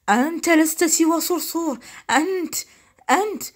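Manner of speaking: high, loud sputtered insult; indignant genie. A narrator's reading. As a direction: splutter in outrage.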